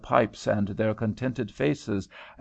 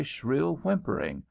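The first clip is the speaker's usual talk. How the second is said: ordinary delivery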